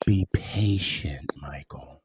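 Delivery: breathy hushed whisper, low. Acted. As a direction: lean in and whisper it. Mock solemn aside to Mike.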